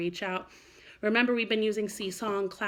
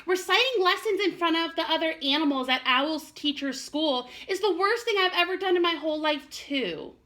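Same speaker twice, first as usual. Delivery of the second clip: whimpering; 9 semitones higher, 4 dB louder than usual. Acted. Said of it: "he whimpered".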